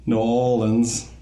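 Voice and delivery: put-on slow, drawn-out New Orleans accent; mock New Orleans drawl. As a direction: introduce a New Orleans belle, unhurried. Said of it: "I bet it was terrible just now".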